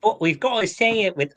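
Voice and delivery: put-on failed New Zealand accent. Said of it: "nowhere near a New Zealand accent".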